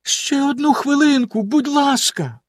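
pleading. Acted. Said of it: "попросила".

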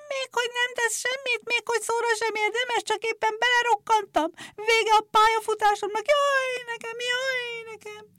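very high, thin shriek of the clippers; thin squealing wail. A narrator's reading. Ruined clippers squealing in thin high despair. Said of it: "vékony hangon felvisított".

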